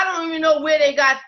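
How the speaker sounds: ordinary delivery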